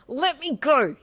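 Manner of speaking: loud high scream. Acted. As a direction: scream it, struggling. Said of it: "you screamed into his chest".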